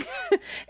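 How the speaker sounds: short high laugh. Real, not acted. Host laughs at own list.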